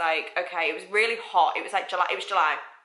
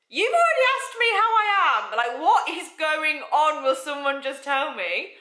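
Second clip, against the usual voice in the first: high, louder mock inner outburst; panicked inner voice; 9 semitones higher, 4 dB louder than usual. Speaker thinking while the boss chats, mock high panic. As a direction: act out a high-pitched silent freak-out. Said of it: "I'm thinking in my head".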